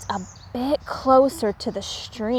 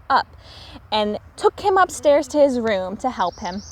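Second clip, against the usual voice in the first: plain reading voice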